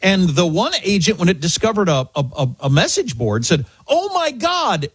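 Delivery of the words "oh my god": high, drawn-out amazed exclamation; oh-my-God amazement, stretched out and high; surprised excitement. Retold or imitated. Host quoting an AI agent's all-caps post, surprised and excited. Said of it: "expressing surprise and excitement".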